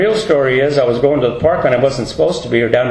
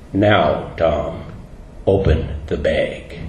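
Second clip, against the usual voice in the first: much lower, softer, slow voice of God; voice of the Lord; 12 semitones lower, 6 dB quieter, much slower than usual. Acted. The Lord telling Tom to face his past, low solemn command. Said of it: "And then the Lord says".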